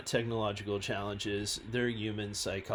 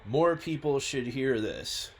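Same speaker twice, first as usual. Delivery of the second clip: ordinary talk, nothing marked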